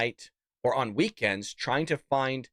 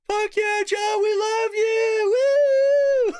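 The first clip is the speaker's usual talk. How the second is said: much higher, louder, hammed-up cheer; mock cheering crowd; 6 dB louder, much slower than usual. Host mocking the convention crowd cheering Joe, hammed-up squeal. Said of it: "They're all chanting for the guy".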